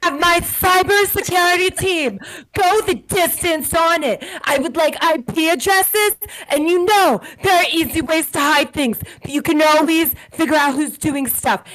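high-pitched, forceful ultimatum; worked-up acted tirade; heated declaration. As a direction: lay down the law to the table. Acts out Lisa's warning to table.

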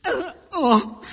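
high, loud "uh, oh"; loud gasped outburst. Retold or imitated. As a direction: crowd losing it, imitated. Imitates auditorium's overwhelmed outburst.

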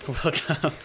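slow, breaking into a laugh; can't keep a straight face, slowly. Real, not acted. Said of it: "I can't even say that with a straight face".

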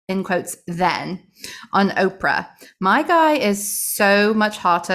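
loud, drawn-out mock-British diary reading; poor British accent. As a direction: read it out in a hammed-up British accent. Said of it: "go with the British accent today, the poor British accent".

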